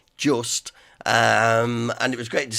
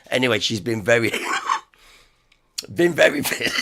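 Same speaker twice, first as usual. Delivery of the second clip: laughing; 4 semitones higher, faster than usual. Real, not acted. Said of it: "so I mustn't laugh".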